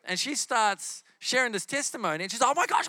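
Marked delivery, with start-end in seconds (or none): 2.4-2.9 high, loud excited babble; retold frantic excitement. Healed woman grabs the microphone to testify, frantically excited. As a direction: burst out with it, too excited to be understood. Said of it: "Slow down".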